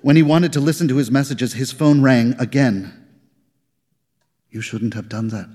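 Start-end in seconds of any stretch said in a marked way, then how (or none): 4.5-5.5 low, hushed, forced voice; hoarse and forced. A narrator's reading. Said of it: "Her voice was hoarse and forced".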